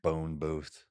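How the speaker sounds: drawn-out Southern Boone Booth; Southern accent. Shown as a demonstration. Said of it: "Can you even do a Southern accent?".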